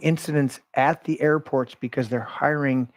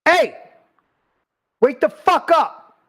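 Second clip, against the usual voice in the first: much higher, loud, slow yell; retold furious shout at a son-in-law; angry shout; 12 semitones higher, 6 dB louder, slower than usual. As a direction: snap him out of it with a shout. Third time telling son-in-law.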